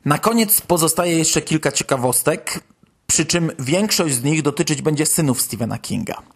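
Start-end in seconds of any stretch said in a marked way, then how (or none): none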